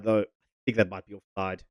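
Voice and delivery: ordinary talk, nothing marked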